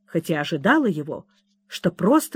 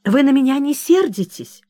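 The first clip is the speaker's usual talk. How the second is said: tearful plea; 6 semitones higher, 4 dB louder than usual. Acted. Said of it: "всхлипнула в заключение бедная Кэдди".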